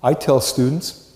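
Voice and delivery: normal talking voice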